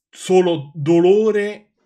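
slow, loud mocking drawl of the words; mock drawn-out. Reader mocking the fanfic's battle, slow loud drawl. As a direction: stretch it out to ridicule the text.

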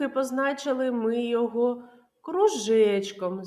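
ordinary talk, nothing marked

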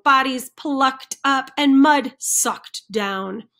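ordinary delivery

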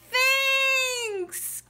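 high, loud, long-held storybook thanks; hammed-up grateful cry; heartfelt thanks. A narrator's reading. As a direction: stretch it out with big gratitude. Bear thanks friends hugging him.

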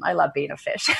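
real laugh bubbling up at the end; amused laughter. Real, not acted. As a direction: joke that tips over into laughter. Jokes about being a Pisces.

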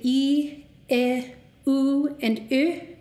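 I, U and Ö as slow, separate held vowels; Icelandic vowel sounds. Shown as a demonstration. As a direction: demonstrate the closing vowels I, U, Ö one at a time. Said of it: "All diphthongs in Icelandic close to the".

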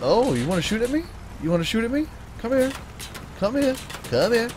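high, teasing taunt at the enemy. Real, not acted. Gamer taunting a bot that shoots at him, high teasing. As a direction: goad him to come closer.

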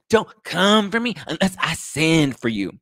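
high, loud, slow mock warning; hammed-up drawn-out outrage; going off. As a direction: imitate a drunk diva's challenge. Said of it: "he's, like, going off me".

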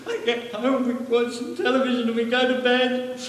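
put-on high, tearful old man's voice; crying old man. Old man on a bench telling a stranger, crying. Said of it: "he was crying".

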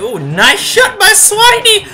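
high, loud excited cheer. Real, not acted. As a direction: shout it out with joy. Live football game commentary.

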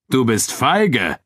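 slow, high, drawn-out taunt; Abu Dun jeering. A narrator's reading. Nubian fighter taunts his reluctant friend, jeering. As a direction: stretch the insult to provoke him. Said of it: "höhnte Abu Dun".

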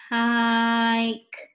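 "Hike" stretched out, long I held; slow. Shown as a demonstration. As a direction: draw out the vowel in "Hike" loudly and slowly. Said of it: "Let's say it slow".

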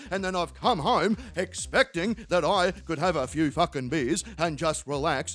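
low-pitched, aggrieved mate; put-on deep voice of a wronged bloke; upset Terry. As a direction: plod through the tale of woe in a low voice. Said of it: "What's got you so upset?".

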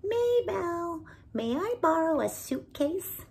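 high, eager little-bird voice; a small bird asking sweetly for a favour; young bird Binkle. Acted. Young Binkle asks Maybelle for a suitcase, high and eager. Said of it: "he asked".

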